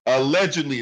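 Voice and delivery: allegedly leaned on, falling at the end; allegedly said with pointed emphasis; stress on allegedly. Real, not acted. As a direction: hit allegedly as a pointed caveat. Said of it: "I do stress the word allegedly".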